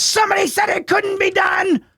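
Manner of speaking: high shouted bowler's victory yell; Pete Weber yell. Retold or imitated. Streamer imitating bowler Pete Weber's victory shout. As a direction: belt it out like a pro bowler who just won. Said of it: "he goes".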